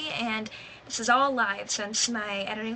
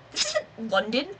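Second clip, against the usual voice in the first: mock British accent. Mocking British Ong pronunciation.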